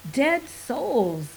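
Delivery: high and loud, a triumphant cry. A narrator's reading. Moore blurting the perfect name to Bocanegra, triumphant and loud. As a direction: proclaim it like a winning answer. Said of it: "said Moore triumphantly".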